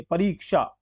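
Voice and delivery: परीक्षा with weight on a long "ree"; long stressed ee. Shown as a demonstration. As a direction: press on री in परीक्षा. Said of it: "जोर देके बोली पड़ रही है".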